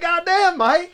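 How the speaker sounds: high, exasperated "God damn, Mike"; exasperated outcry. Retold or imitated. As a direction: cry out at the friend, half amused. Finds his friend passed out.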